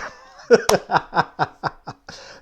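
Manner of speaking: loud laugh bursting out; hearty laugh. Real, not acted. Laughing at his own story.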